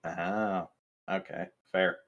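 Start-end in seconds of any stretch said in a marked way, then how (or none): none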